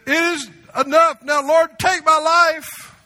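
high, slow, pleading cry to God; high anguished plea, slowing on take my life; Elijah's despairing prayer. Retold or imitated. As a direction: beg the Lord to end it, drawn out. Elijah prays to die.

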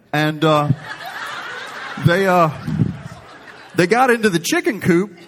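imitating dad's earnest farm tale, high and laughing through it; straight-faced old preacher, cracking up; dead serious dad. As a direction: son retelling his deadpan dad while laughing. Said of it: "Serious as could be".

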